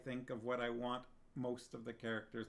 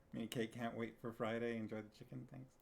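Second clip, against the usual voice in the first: usual speaking voice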